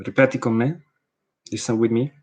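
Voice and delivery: normal talking voice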